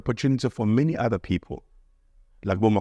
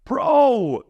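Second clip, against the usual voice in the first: excited exclamation; 6 semitones higher, much slower than usual. Real, not acted. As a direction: burst out in disbelief. Recalling his own mess.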